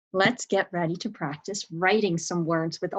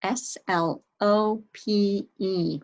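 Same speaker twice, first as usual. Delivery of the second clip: letters of "slope" spaced out slowly; slow spelling; 3 semitones higher, slower than usual. Shown as a demonstration. Teacher slowly spells the word for young students. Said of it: "How'd you spell slope".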